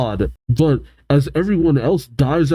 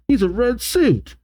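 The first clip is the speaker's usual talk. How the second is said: higher, lightly voiced character; 10 semitones higher than usual. Y describing the intruder, lightly voiced character. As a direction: lightly act the line up high. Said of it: "Y says".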